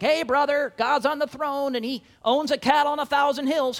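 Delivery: hammed-up jolly churchgoer. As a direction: slap him on the back as you say it.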